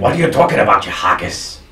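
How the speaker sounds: a light, hammed-up Scottish accent; mock Scottish accent. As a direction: tease in an overdone Scottish accent. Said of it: "With the worst Scottish accent ever".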